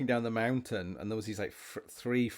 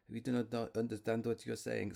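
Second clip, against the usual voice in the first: mock French accent; 5 dB quieter than usual. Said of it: "that stupid line".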